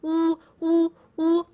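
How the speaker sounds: oo with the lips pushed out in a pout. Shown as a demonstration. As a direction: pout and hoot oo. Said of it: "lips are lifted as if you are pouting".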